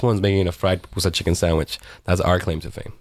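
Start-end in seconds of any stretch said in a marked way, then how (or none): none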